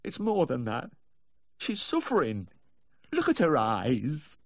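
high, anguished plea, slowing at the end; tearful, lips trembling. A narrator's reading. Owner insisting his dog is suffering, tearful plea. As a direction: plead high, dragging out the last words. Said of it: "his lips began to tremble again".

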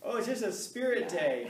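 high, slow, airy innocent excuse; mock innocent excuse. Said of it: "you get all made up for a".

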